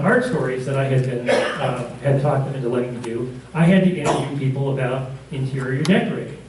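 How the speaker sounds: ordinary talk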